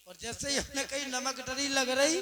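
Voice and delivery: ordinary delivery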